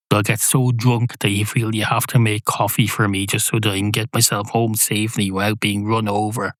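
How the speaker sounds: ordinary talk, nothing marked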